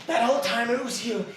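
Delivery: ordinary talk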